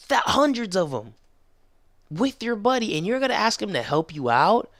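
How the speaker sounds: high-pitched, stretched-out disbelief; incredulous. Retold or imitated. Viewer challenges the villain asking for help, incredulous. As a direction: act out an outraged double take, leaning on the words.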